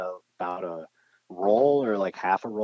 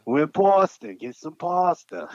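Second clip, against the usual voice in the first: tough Boston guy; 9 semitones higher, 4 dB louder, slower than usual. Retold or imitated. Mock tough Boston guys.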